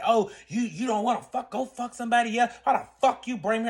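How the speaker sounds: mock furious boyfriend; angry comeback. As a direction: act out his indignant tirade.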